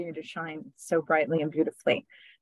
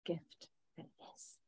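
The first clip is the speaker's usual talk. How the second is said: whisper; 20 dB quieter than usual. Acted. Channeled voice fades out.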